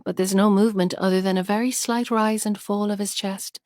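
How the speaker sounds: plain reading voice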